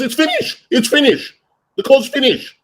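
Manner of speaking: very high mock whine of insistence; imitated protest. Housekeeper insists the laundry is done, mock protesting. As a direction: mimic the helper defending himself.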